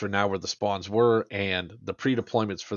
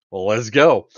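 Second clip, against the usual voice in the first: louder, rallying push; rallying call; 9 dB louder, slower than usual. Retold or imitated. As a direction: spur your buddy forward. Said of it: "I was like".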